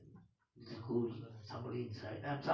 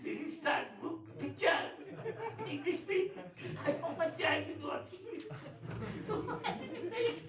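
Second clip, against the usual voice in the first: real helpless laughter, higher; laughing; 12 semitones higher than usual. Real, not acted. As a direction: tell it while you can't stop laughing. Laughing through the story.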